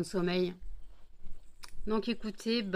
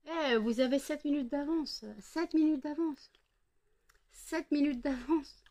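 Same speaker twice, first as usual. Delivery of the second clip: teasing dogs; 6 semitones higher than usual. Real, not acted.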